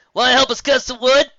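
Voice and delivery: high, loud hail across the beach; loud friendly shout; calling out. A narrator's reading. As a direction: call them over loudly. Calling out from afar.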